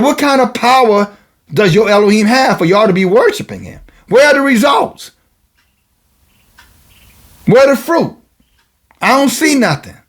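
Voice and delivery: high, demanding preacher's questions; challenging. Acted. Prophet's acted challenge to idol worshippers.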